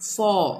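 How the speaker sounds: "for" with a silent r; British pronunciation. Shown as a demonstration. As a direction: say "for" as "faw", long open vowel. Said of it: "इसको कैसे पढ़ेंगे British English के हिसाब से". ("for" में r silent, "फॉ")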